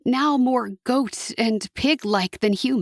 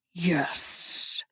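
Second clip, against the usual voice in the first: low, soft devil's yes; a quiet, dark yes; 5 semitones lower, 7 dB quieter than usual. A narrator's reading. Hasatan's voice.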